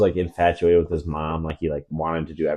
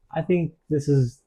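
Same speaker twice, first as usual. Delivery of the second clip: lightly voiced girl; 5 semitones higher, 4 dB louder than usual. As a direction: lightly play a girl who is into it. Voices the girl egging him on.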